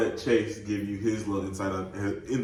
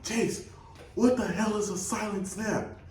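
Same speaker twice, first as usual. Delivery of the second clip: whisper yelling; 10 semitones higher than usual. Retold or imitated. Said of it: "I'm, like, whisper yelling".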